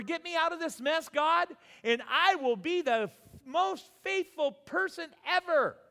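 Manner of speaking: high, loud mock plea to God, hammed-up; desperate bargaining shout. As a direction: yell the bargain like a panicked believer.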